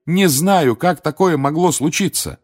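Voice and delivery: loud, high, helpless protest; exasperated denial; insistent. A narrator's reading. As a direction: keep pleading innocence. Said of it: "снова и снова твердил Гарри".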